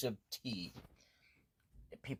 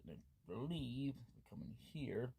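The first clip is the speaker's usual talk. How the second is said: ordinary delivery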